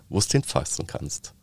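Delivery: ordinary delivery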